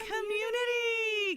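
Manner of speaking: high, slow, sing-song word; mock innocent correction. Correcting chat's cult joke with mock innocence, high and slow. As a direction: pretend innocence while correcting a slip. Swaps "cult" for "community" jokingly.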